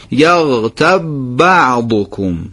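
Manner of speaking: slow, the ب of یغتب doubled into بعضکم; fuse یغتب بعضکم, ب held with no jolt; joined, no bounce. Shown as a demonstration. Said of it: "تکون نخوره".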